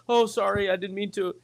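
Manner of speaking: sheepish, backing off; passer-by apologising to box guy; meek apology. Retold or imitated.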